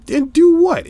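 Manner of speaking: incredulous, voice leaping way up; exasperated retort. Acted. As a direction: throw it back at the critic in squeaky disbelief. Retorting to the imagined critics.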